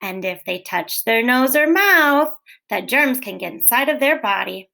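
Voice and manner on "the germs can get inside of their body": usual speaking voice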